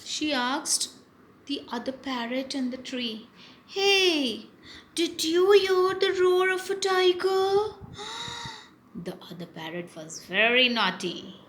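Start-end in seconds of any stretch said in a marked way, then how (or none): 3.7-7.7 high, loud alarmed question; scared parrot. Acted. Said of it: "She's scared". 7.8-8.6 high acted gasp of fear; frightened gasp. Frightened parrot in the story.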